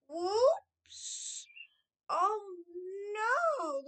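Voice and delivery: high, loud oh no as the drink spills; dismayed cry. Retold or imitated. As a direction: yelp as the tray tips. Spilling a margarita on him.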